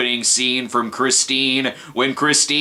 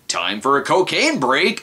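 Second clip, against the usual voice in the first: ordinary talk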